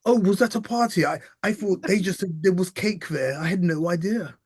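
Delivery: high, loud, mock-innocent politician voice; Boris Johnson. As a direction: play dumb like a caught-out politician. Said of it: "when Boris Johnson said that".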